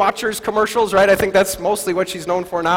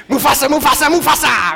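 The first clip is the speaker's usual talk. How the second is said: a hint of the booming Lion King call; Lion King echo; 3 semitones higher than usual. Pastor mimicking the Lion King cry to the congregation, lightly voiced. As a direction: echo the name like the movie scene.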